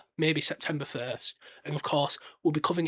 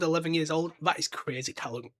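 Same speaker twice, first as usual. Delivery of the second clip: ordinary talk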